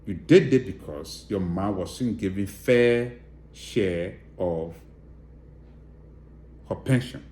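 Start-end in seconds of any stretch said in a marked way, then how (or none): none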